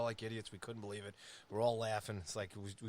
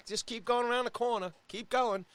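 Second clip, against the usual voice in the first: hammed-up cheerful directions, louder and higher; mock locals; 9 semitones higher, 7 dB louder than usual. Locals waving the lost fans on, mock cheerful. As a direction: brush them off with a grin.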